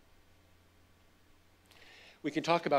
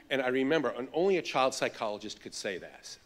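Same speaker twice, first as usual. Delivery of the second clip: ordinary talk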